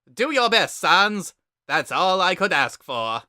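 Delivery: high, loud, booming skeleton brother; loud high cartoon brother voice; Papyrus voice. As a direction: bellow it out like an eager kid brother.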